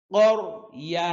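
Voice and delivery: ordinary talk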